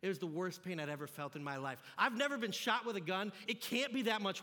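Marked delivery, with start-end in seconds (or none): none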